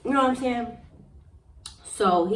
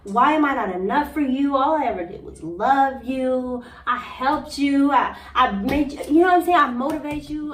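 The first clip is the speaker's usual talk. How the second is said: pleading cry; 5 semitones higher, 5 dB louder than usual. Retold or imitated. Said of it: "bawling, tears coming".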